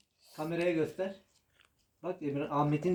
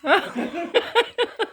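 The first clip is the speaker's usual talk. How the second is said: high laugh; 14 semitones higher, 6 dB louder than usual. Real, not acted. Laughing over the burning taste.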